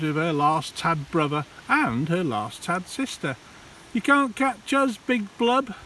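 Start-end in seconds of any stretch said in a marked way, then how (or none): 3.9-5.7 high, defiant taunting call. Acted. Last sister taunting Big Blub from the shallows, high and defiant. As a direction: shout a dare into the dark. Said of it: "called out into the shadows".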